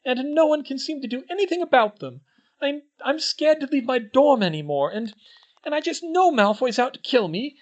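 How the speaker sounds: high, loud, fast frightened voice; on the edge of tears; scared girl. A narrator's reading. Hermione confides her fear to the twins, high and scared.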